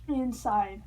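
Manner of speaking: ordinary delivery, nothing marked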